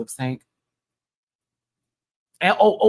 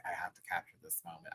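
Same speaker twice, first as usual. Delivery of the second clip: quiet; 6 semitones lower, 18 dB quieter than usual. Retold or imitated. Retold thought, very soft.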